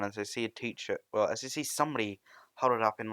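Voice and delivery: ordinary talk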